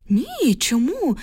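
high, drawn-out puzzled question; slow, surprised reply, voice lifted high. A narrator's reading. Woman on the phone answering the caller, surprised. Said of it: "здивувалася жінка на дроті".

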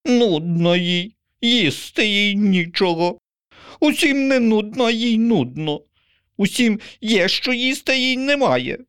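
mock the crow's whining; indignant pigeon. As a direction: pigeon scolding the crow. Pigeon rants.

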